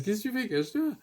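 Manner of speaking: high-pitched, incredulous scolding; comic disbelief, pitched well up; mock exasperation. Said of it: "comme ça, tu fais".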